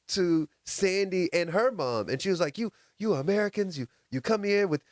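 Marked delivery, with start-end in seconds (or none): 2.6-4.8 mock trash talk. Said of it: "starts talking trash".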